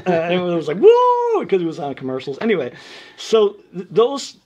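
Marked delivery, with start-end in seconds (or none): 0.8-1.5 high, loud imitated whoop; crowd whoop. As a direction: whoop like an excited audience. Imitates everyone reacting to the song.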